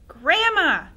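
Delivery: loud, high cry of alarm; boy crying out. Acted. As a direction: shout at her in fright. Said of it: "he cried out".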